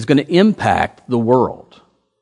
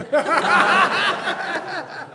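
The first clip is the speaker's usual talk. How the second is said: high laugh; 5 dB quieter than usual. Real, not acted. Laughs after own joke.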